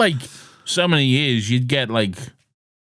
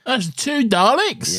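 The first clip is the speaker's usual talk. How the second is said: much higher, slow disbelieving question; incredulous; 13 semitones higher, slower than usual. Retold or imitated. Friend reacts to the doubled Daleks, high and slow disbelief. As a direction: stretch it out in mock disbelief. Joining the retold scene.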